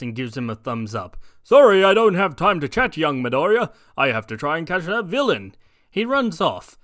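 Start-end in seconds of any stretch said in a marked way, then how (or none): 1.4-5.5 loud cheerful hero in a hurry; brushing off the chat; All Might voice. Acted. All Might to young Midoriya, loud and hurried.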